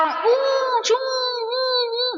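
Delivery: high excited cheering yelps; mock race cheer. Fan at a motorbike race, mock excited cheering. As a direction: cheer on the rider like a fan in the stands.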